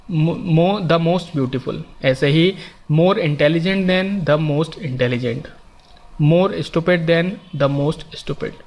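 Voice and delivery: very slow, each form spelled out; slow teaching demo. Shown as a demonstration. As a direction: say every form slowly for students to copy. Teacher spells out example forms. (उदाहरण बहुत धीरे-धीरे बोलना)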